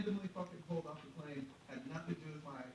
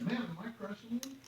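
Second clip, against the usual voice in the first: high-pitched, pleading question; urgent appeal; 10 semitones higher, 4 dB louder than usual. Retold or imitated. Passenger asking the old lady beside him, urgent. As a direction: appeal to a witness, voice way up.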